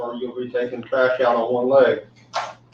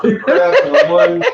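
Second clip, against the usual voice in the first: laughing; 9 dB louder than usual. Real, not acted. Laughing joke.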